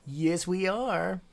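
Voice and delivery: loud, high, drawn-out cheer; triumphant affirmation. Real, not acted. Streamer agreeing they're all millionaires, triumphant. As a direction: stretch it out slowly and proudly.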